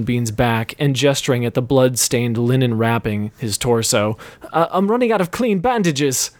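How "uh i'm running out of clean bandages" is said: higher-pitched nervous barkeep; fretful, a bit whiny; lightly voiced frightened barman. A narrator's reading.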